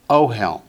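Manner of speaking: "ohel" with the first syllable stressed, falling away; teacher's clear "ohel", accent up front. Shown as a demonstration. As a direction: hit the opening "o" of "ohel". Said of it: "Accent on the first syllable".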